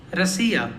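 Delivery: "Rasiya" with a light "ra" and stressed "si"; reduced first vowel. Shown as a demonstration. Teacher repeating the correction, reduced first vowel in "Rasiya". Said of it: "are not that much pronounced".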